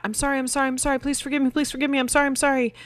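put-on high, breathless-paced run of kid's pleas; frantic mock apology. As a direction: imitate a child begging out of trouble. Mimics the old panicked apology.

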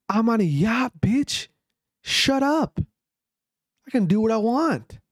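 high-pitched, mock-indignant comeback; indignant boast. Rich eater on a yacht to his critic, indignant boast. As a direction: play the rich big shot shutting a critic down.

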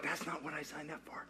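a whispered, dismayed aside; whispered complaint. Retold or imitated. Disciple whispers his complaint about washing feet. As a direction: breathe it out like a grumbling disciple.

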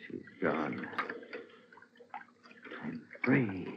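very low, hushed murmur; near-whisper of disbelief; stunned relief. Acted. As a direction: barely voice it, halting and spent. Husband alone after the drowning.

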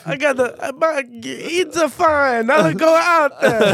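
high, loud, slow Italian-ish swagger; cocky pigeon husband. Pretend. Pigeon husband reassuring his wife before going out, high loud swagger. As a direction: brag that nothing can touch you.

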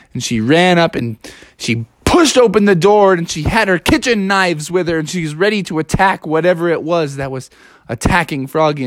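usual speaking voice

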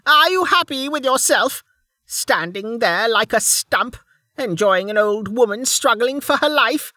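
high, indignant crone voice; spitting old woman. Acted. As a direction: spit it out as a high-pitched, outraged old woman. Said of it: "Then she spat".

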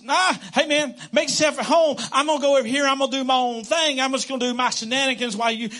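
casual dismissive buddy voice; careless host. Pretend. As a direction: play the guest who can't be bothered. Mock careless host to God.

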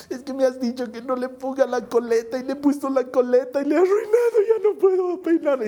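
put-on high little-girl wail, rising; crying child. Daughter confessing she ruined the doll's hair, crying child. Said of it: "Y mi hijita llorando".

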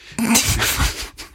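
unvoiced laugh. Real, not acted. Joke just landed, laughing.

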